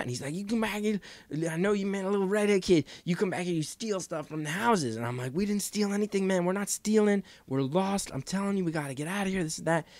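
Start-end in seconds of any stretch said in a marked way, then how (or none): 0.3-4.9 imitated yelling. Big stranger accusing a lost kid of stealing, imitated yelling. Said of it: "And he's yelling at me". 5.3-9.9 high, urgent mock pleading; imitated protest. Lost driver denying theft to scary stranger, imitated pleading.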